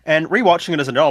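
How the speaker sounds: ordinary talk, nothing marked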